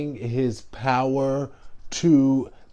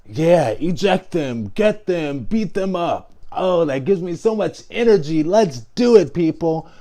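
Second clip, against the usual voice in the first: higher, louder hammed-up rally voice; mock a fired-up crowd-pumper; mocking Trump; 5 semitones higher, 5 dB louder than usual. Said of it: "him being like".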